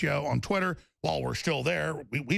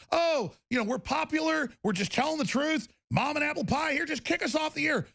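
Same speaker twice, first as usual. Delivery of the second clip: higher mocking sing-song, mimicking the network; 9 semitones higher than usual. Mocking the network bosses who pulled the show, higher sneering singsong.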